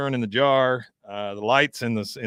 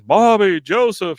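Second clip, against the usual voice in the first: loud, high calls across the desert; calling out; 8 semitones higher, 8 dB louder, slower than usual. Retold or imitated. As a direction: call the boys' names into the dark. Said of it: "he's calling out to 'em".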